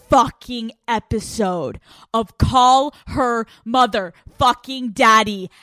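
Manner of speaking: slow, punchy announcer delivery; hype show intro. Real, not acted. Host announcing the hundredth episode, hyped show intro. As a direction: drag out every word of the big title.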